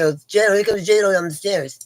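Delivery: high, loud mock-hype call of "J-Lo"; teasing catcall. Inmates tease her as J-Lo coming downstairs, loud playful call. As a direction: hype her up like a star on the stairs.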